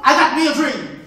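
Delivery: loud, declaiming stage voice; stage actor. Acted. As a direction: project it to the back row. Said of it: "Man say to his woman".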